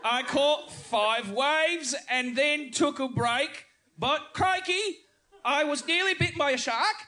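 high, slow hammed-up Australian accent; mock Australian accent. John in the Australian dialogue, hammed-up high Australian accent. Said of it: "I'll be John".

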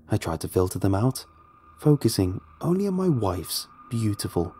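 ordinary delivery

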